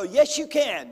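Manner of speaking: high, loud pep-talk cheer; mock preacher's cheer; loud encouragement. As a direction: pump them up like a coach. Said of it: "people like me go".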